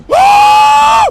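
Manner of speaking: piercing shriek; high terrified scream. Real, not acted. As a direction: shriek at a jump scare. Jump scare.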